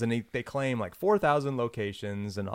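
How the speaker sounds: usual speaking voice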